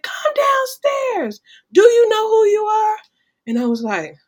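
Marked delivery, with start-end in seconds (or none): 0.0-3.0 high, loud commanding call from downstairs, drawn out; mother's call. Retold or imitated. Mother calling her daughter downstairs, a loud high summons. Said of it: "She said".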